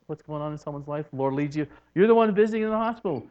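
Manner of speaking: normal talking voice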